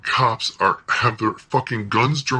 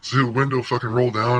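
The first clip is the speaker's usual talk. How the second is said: ordinary talk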